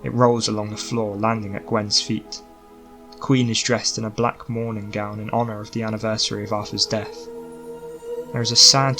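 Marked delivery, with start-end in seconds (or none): none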